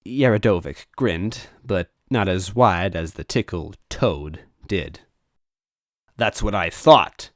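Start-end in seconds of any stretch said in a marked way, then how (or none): 6.2-7.3 scowl. Acted. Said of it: "he scowled".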